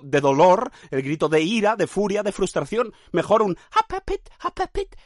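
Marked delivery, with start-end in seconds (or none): 3.7-4.9 very high squeaky clown yelps; cheerful agony cries. Pretend. Clown in agony, cries painted in cheerful colours. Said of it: "Son gritos de agonía".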